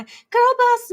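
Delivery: very high, loud mock slogan; hammed-up, high-pitched rallying call; mock girl-boss cry. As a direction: sing it out high as a mock cheer.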